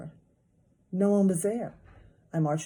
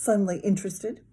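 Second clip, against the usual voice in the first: ordinary delivery, nothing marked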